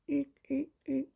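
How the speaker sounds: very high squeaky eeks; tiny squeaks. A narrator's reading. Little Fing squeaks.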